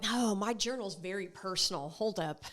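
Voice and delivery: mock private aside. Guarding her journal from listeners, soft teasing aside. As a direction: hush it like hiding a secret.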